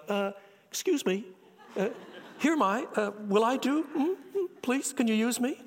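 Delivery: a crushed man shyly raising his hand; humble Isaiah. Retold or imitated. Isaiah offering himself to God in timid, halting humility. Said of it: "I think he's saying something like".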